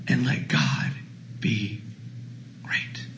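a hushed close; fading to a hush. Acted. Closing words fade.

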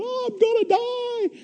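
very high, slow, soft mock terror; a hammed-up terrified rider on camera. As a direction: wail it like you're about to die. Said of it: "screaming with your mouth wide open and going".

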